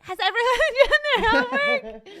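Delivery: high, loud, laughing strict aunt; strict auntie voice. Retold or imitated. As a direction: bark it like a laughing taskmaster. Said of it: "I'll be like".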